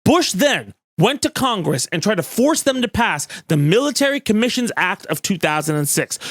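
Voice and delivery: ordinary delivery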